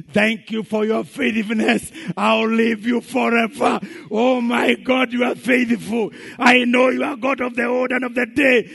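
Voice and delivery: overjoyed shouting praise; ecstatic thanksgiving. Retold or imitated. Woman celebrating loudly.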